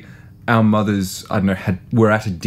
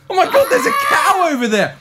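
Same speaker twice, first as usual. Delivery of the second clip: high squealing laugh while shouting. Pretend. Seagull skit, laughing shout.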